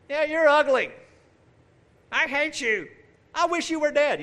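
high, loud mock schoolyard taunt; preacher imitates childhood friends trash talking him, high and taunting; childish taunting. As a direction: sneer like a nasty kid on the playground. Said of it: "I remember being trash talked".

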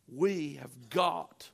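slow, drawn-out "We have got"; deliberate, weighty declaration; emphatic resolve. Acted. Voicing Israel's resolve in preaching.

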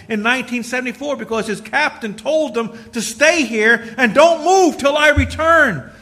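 full-force sermon climax; fiery preaching. Acted. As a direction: preach it hard to the church.

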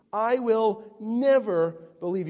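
never hit hard, loud and high; preacher repeats Thomas's vow, stressing never. Shown as a demonstration. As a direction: lean into never. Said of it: "repeated for emphasis".

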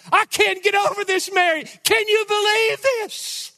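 overwhelmed with wonder, calling out; excited Elizabeth. Acted. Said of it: "Elizabeth is saying".

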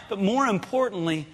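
dropping to a soft, grave warning; hushed warning. Acted. Warning Eve of her son's murder, suddenly hushed. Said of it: "if we could just step up and say".

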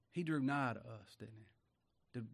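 ordinary talk

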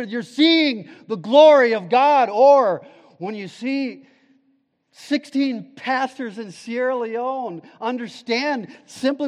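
loud, high, fervent sermon voice; preaching at full force. Acted. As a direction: preach it out to the congregation with fire. Preacher building to a climax.

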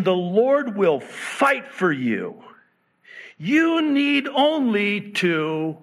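full-force preaching. Acted. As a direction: proclaim the verse to the church.

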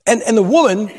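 usual speaking voice

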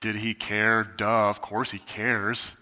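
low, slow, dismissive scoff; scoffing dismissal. Retold or imitated. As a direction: roll your eyes at the disciples, voice dropped. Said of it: "we look at it and we go".

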